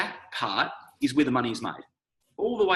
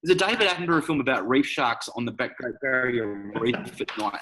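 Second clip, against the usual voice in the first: normal talking voice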